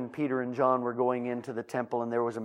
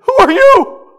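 very high, loud shrieking question; wife who doesn't recognize him; startled wife; 22 dB louder, slower than usual. Acted. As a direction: cry out at a stranger. Wife voiced in story.

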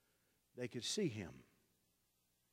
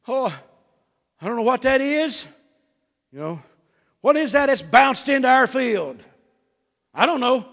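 high, loud, alarmed sheep asking what bounced into the field; a sheep panicking at a strange sound; frightened sheep; 9 semitones higher, 15 dB louder than usual. Retold or imitated.

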